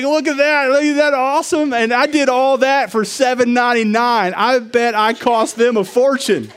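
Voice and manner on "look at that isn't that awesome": proud boast. Retold or imitated. Boasting at the buffet.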